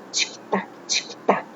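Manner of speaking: breathy clock ticking sound, barely voiced; whispered tick-tock. Shown as a demonstration. Teacher whispers tick-tock to show onomatopoeia, very soft. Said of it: "do relógio, onomatopeia".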